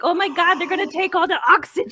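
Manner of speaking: high, loud, hurried mock alarm; comically alarmed outburst; mock panic. Voices yawner's panic over oxygen.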